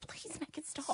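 breathless whispered mock plea. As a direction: whisper it like you're suffering. Mock plea about bad accent.

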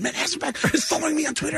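fast, high-pitched excited outburst; flipping out. Retold or imitated. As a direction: insist in giddy disbelief, racing along. Flipped out, answers unimpressed friend.

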